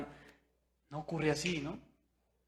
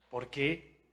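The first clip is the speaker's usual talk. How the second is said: ordinary delivery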